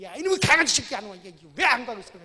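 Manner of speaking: furious, loud shout at a dog; angry yell. Pretend. Owner shouting at following puppy, loud angry yell. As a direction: roar to scare the puppy off. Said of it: "호통을 쳐야죠".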